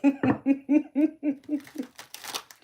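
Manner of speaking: loud, high burst of laughter, falling away; a real laugh at her own brag; big laugh. Real, not acted. Bragging to the chat that guys can't handle her, a loud laugh.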